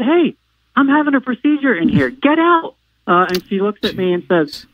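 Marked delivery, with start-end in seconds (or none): 0.0-2.7 high-pitched retold indignation; indignant demand. Patient to a visitor intruding mid-procedure, indignant. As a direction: order the intruder out, voice pitched right up.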